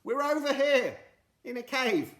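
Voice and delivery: high, loud calling out; shout. Acted. Friday calling to the Rainbow Spirit, loud and high. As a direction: call out across the sky. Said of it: "he shouted".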